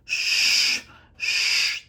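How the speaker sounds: loud, harsh whispered shush; mom's loud shushing. Retold or imitated. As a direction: hush everyone like an angry Filipino mom. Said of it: "my mom can come out and do this".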